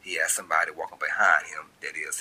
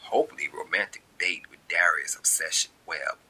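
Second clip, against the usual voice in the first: ordinary talk, nothing marked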